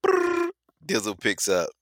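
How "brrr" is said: high trilled "brrr" ringtone; phone ring imitation. Shown as a demonstration. Acting out the phone ringing, high trilled imitation. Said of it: "Phone rings".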